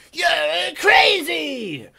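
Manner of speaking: high, loud, long-drawn shriek; mock horrified scream. Mother watches her child smash the TV, mock horrified scream. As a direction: mimic a mother screaming, stretching every word.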